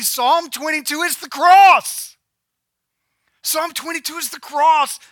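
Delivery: high, forceful proclamation; emphatic preaching. Acted. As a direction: drive the point home to the congregation. Preacher proclaims the key point.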